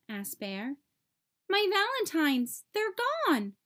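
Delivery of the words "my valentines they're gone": put-on high, distressed young voice; a child crying out that his cards are gone; upset cry. A narrator's reading. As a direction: blurt it out in dismay. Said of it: "cried Franklin".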